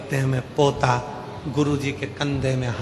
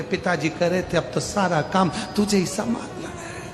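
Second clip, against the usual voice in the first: higher, softening mock sob; lightly acted weepy voice; mildly tearful son; 6 semitones higher, 5 dB quieter than usual. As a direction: plead softly with pretend tears. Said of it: "बेटा रोते रोते बोले".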